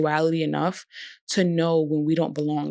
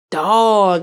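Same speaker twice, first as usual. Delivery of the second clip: ordinary talk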